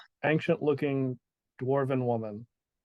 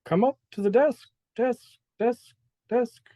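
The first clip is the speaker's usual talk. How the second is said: high distant call with the last word echoed; echoing call; 10 semitones higher, 4 dB louder than usual. Acted. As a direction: make your own echo on the final word. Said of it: "it just, like, echoes".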